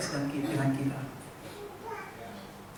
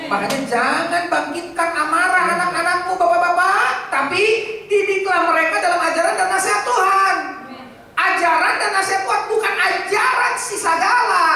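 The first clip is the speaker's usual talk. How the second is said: louder and higher, hammering the verse home; fiery preaching; 10 semitones higher, 9 dB louder than usual. Acted. Pastor thundering the verse at fathers, full force preaching.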